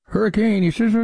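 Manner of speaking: "Hurricane" sliding into slurred mush at "you should"; mock garbling. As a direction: garble the name after "Hurricane". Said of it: "watching people try to say".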